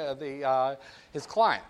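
ordinary delivery

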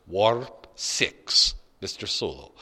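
put-on low, understated Scottish accent. Scottish voice from the back seat to the driver, light Scottish accent. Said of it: "a voice with a curiously Scottish accent".

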